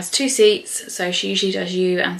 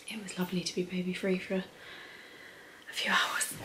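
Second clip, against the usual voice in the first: guilty whisper; 12 dB quieter, faster than usual. Real, not acted. Confessing guilty pleasure, "don't judge me.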